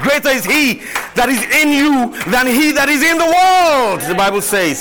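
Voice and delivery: high, loud preaching to the church; full-force preaching. Acted. Pastor preaching to the congregation, full-force preaching. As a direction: preach it out, rising with each line.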